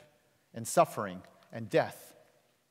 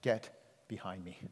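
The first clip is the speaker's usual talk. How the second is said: simple correction; 5 semitones lower, 7 dB quieter than usual. Retold or imitated. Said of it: "offers a simple correction".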